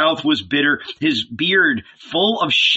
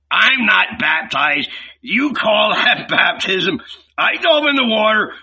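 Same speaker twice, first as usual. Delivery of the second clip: scornful yell; 6 semitones higher than usual. A narrator's reading. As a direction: bellow the insults at them. Innkeeper mocks baptism.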